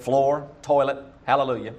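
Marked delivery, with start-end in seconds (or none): none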